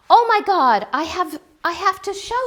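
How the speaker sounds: high, loud sudden excitement; excited exclamation. Real, not acted. About to show viewers a gift, a high excited burst. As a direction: blurt it out, thrilled.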